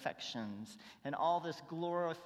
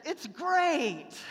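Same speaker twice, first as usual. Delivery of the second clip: put-on much higher, louder child's "great"; little girl; 12 semitones higher, 12 dB louder than usual. [name] quoting his daughter Tiffany, much higher and louder. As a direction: squeal it like a delighted little girl. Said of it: "she said".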